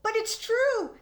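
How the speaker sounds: high, loud protest; insisting. Acted. Boy insisting to his father, high protest. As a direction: plead to be believed. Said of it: "Barry insisted".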